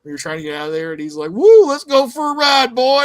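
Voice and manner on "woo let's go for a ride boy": mock Jack Baker yell. Friend retelling the garage scene, loud mock taunt. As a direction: whoop it out like a gleeful maniac.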